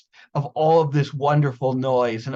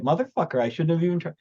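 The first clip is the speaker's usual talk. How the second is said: ordinary talk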